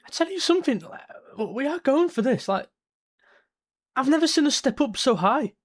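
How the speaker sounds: high, excited fan rising to the moment; can't contain the buzz, pitch up; thrilled. Real, not acted. Fan buzzing as his team presses high, excited.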